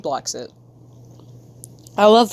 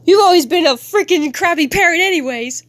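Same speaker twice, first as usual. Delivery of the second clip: high, heated acted outburst; angry accusation; 8 semitones higher, 4 dB louder than usual. Kid lashing out at a parent, angry accusation. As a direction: hurl it out high-pitched.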